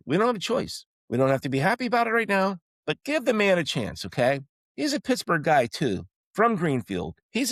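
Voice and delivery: rally the fanbase, voice climbing high; excited pleading. Real, not acted. Fan pleading to give coach chance.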